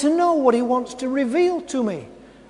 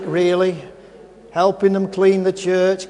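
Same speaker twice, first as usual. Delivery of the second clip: ordinary talk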